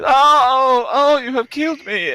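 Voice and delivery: high, loud squeaky kobold cry of defeat; shrill dying-monster yelp. Acted. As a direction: wail it out as a small creature struck down. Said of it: "uh, he".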